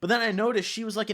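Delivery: ordinary talk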